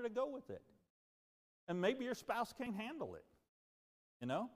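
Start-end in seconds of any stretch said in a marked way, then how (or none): none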